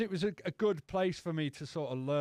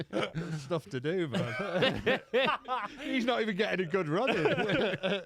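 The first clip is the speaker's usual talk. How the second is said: higher, laughing through the words; giggling while telling it; 3 semitones higher, 4 dB louder, slower than usual. Real, not acted. Telling how slow he ran with his friend, laughing.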